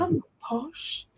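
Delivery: mock posh. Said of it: "you do sound a little posh".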